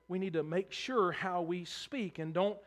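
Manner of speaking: normal talking voice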